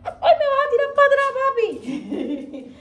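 laughing squeal. Real, not acted. Owner squealing as her dog pushes her back. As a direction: squeal as the dog nearly knocks her over.

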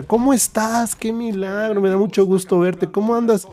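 high, excited run of greetings; imitated happy surprise; retold delighted greeting.

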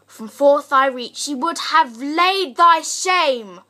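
dramatic Shakespearean monologue, measured with heavy pauses; stage verse delivery, forceful and deliberate; John of Gaunt. Acted. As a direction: declaim it as a reproach to a king. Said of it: "John of Gaunt's monologues from Shakespeare's Richard the Second".

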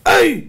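high, loud "ow" cry; comic high-pitched cry; mock yelp of pain. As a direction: yelp as if struck. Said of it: "הוא צועק".

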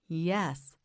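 usual speaking voice

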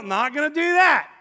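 loud, high-pitched retold refusal; acted-out emphatic no; stubborn refusal. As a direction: dig your heels in, raising your voice. Acted refusal to wife's suggestion.

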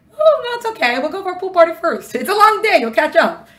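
high, pushy voice of the animal soul urging a pool party over prayer; coaxing animal soul. Acted. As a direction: play the inner voice that talks you out of prayer.

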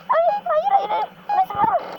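very high no no no; panicked squeal. Real, not acted. Shrill panicked refusal.

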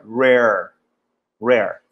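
rare with both R's curled; rolled R. Shown as a demonstration. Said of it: "O R é bem enrolado".

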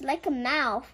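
usual speaking voice

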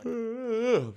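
a long, drawn-out yawn with the words stretched high. Real, not acted. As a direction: say it through a real yawn. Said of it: "I'm yawning".

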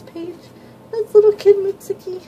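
high, bright and loud on the reveal; light up at the picture; excited. Real, not acted. Fan revealing a cute page, high excited voice.

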